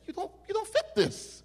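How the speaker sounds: very high-pitched, stammered disbelief; baffled insistence. Retold or imitated. Officer looking at the arrested man, high baffled insistence. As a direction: act out the officer squeaking in disbelief.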